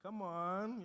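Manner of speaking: ordinary delivery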